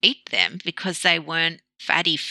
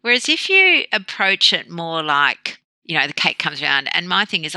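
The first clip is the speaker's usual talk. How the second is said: ordinary talk, nothing marked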